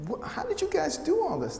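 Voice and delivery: stammered, high-pitched mock amazement; amazed. Colleagues amazed at prams and baby things, imitated astonishment. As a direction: imitate someone lost for an explanation.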